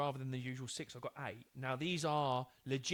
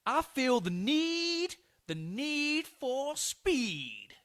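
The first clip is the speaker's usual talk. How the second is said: much higher, very slow, loud Top Gun line; film quote impression; 9 semitones higher, 7 dB louder, much slower than usual. Shown as a demonstration. Film quiz line from Top Gun, drawn out slowly, high and loud. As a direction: stretch every word out, high and loud. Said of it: "do the voice as best I can".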